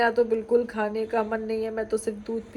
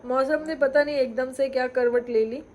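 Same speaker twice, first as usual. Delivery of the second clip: ordinary delivery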